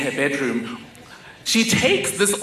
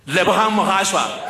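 pageant announcer; 3 semitones higher than usual. Acted. Pageant organizer announces the winner to the hall, announcer's call. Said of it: "then they announce the winners".

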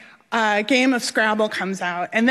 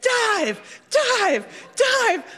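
imitated crowd chant, each word pitched high and falling; mock shout from an onlooking crowd, high and punched; 11 semitones higher, much slower than usual. Crowd on the shore urging her to dive, shouting. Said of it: "they're all shouting".

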